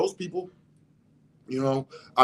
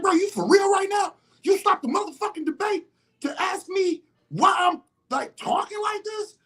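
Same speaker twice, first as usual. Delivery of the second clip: fired-up debate shout; heated shout. Retold or imitated. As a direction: blast it back at him in disbelief. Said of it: "see how I'm going right now? That's the energy I had".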